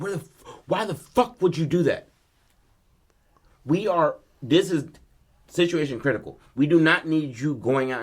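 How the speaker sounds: acted-out exasperated dad, words punched; losing it. Father scolding son by the car, losing his temper.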